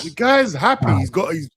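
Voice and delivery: high, insistent pleading; playfully worked-up, high-pitched protest; exasperated plea. Real, not acted. Playful pleading to leave player alone.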